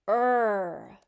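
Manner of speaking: "er" held long as one sound. Shown as a demonstration. Teacher giving the third sound in evergreen, sounded out. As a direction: draw out "er" as a single isolated sound. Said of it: "practice sounding out".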